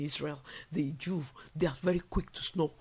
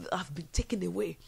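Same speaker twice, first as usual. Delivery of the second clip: whisper. Acted. Whispered take before repeat.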